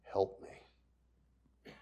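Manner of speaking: a broken man begging softly through tears; tearful whispered plea. Retold or imitated. Preacher retelling a crying stranger, whispered plea. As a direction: whisper Help me, voice breaking. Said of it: "crying like a baby".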